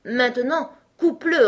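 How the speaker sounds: high, loud command; giving orders. A narrator's reading. Lion telling Alice to cut, commanding. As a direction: order it outright. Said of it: "ordonna le lion".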